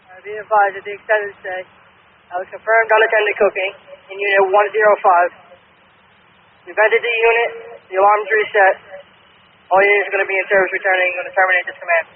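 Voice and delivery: urgent firefighter radio. Real, not acted. Fire crew radio report.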